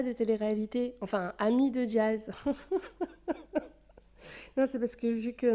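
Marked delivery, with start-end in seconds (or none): 2.4-3.1 high-pitched giggle; high laugh. Real, not acted. Host amused by own jibe at reality candidate, high giggle.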